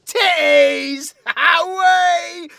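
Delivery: very high, drawn-out shout; full-volume yell, both words stretched out; comic battle cry. Acted. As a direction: holler it like a silly hero's charge.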